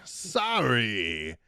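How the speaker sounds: put-on loud, high, over-the-top French accent; mock-clueless Frenchman, slow fake accent; bad French accent. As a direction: ham up the French and stretch it way out. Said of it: "really shitty, bad French accent".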